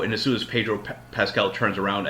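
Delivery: ordinary delivery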